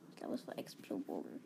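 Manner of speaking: hushed unvoiced aside; whispering. Real, not acted. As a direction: whisper it to the mic. Whispered line.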